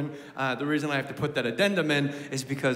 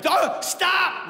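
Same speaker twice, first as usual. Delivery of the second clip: mock yell at kid; 12 semitones higher than usual. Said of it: "you're like".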